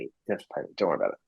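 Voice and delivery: quietly brushing off the payment. Retold or imitated. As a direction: hush it down, waving it off gently. Retold reassurance, said twice.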